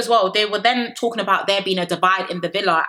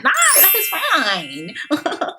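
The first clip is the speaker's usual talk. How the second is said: much higher, very slow, drawn out; mock dismissive Megan; 8 semitones higher, much slower than usual. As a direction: stretch out a dismissive no, that is fine. Megan's reply, acted out.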